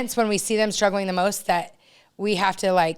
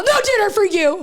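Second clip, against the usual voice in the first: high, louder mock-stern decree; mock strict mom; 11 semitones higher, 4 dB louder than usual. Joking she'll punish her kids, hammed-up stern high voice. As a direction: ham up a strict mother laying down punishment. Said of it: "today I'm all".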